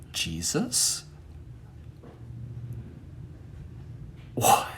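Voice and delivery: soft, low, then whispered; hushed astonishment. Retold or imitated. Storyteller meeting the man's eyes, hushed astonishment. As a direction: breathe it in mock awe, long pause.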